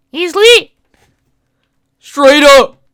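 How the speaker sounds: high, loud hype yell; hype shout. Acted. Hype man to the crowd, yelling high and loud.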